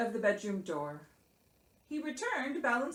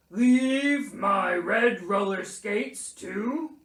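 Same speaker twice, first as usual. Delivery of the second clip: loud, slow dictation, held in suspense; pompous Bear; 9 dB louder, slower than usual. A narrator's reading. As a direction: dictate a will grandly. Bear dictates his will.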